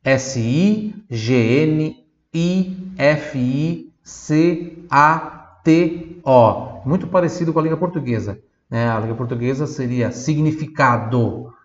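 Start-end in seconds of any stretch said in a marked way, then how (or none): 0.0-6.8 letters spelled out very slowly; slow spelling. Shown as a demonstration. Teacher spells the word slowly for the class. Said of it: "spelling letters".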